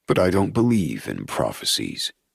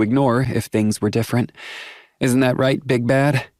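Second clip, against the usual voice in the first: put-on young woman voice; 5 semitones higher than usual. A narrator's reading. Willow speaks candidly, teases.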